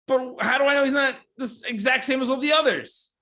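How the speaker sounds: high, exasperated pitch; indignant protest. Real, not acted. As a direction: push back in mock outrage. Arguing about Mister Potato Heads.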